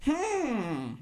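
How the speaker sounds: high drawn-out villain hum; supervillain. Acted. Villain has captured the agent, high mock-pondering hum.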